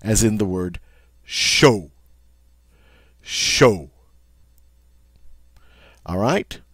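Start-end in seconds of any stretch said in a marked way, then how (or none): 1.2-3.9 "show" with a long O as in no. Shown as a demonstration. Teacher gives the example word, long O sound. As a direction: hold the long O at the end of "show". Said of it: "it's a long O sound".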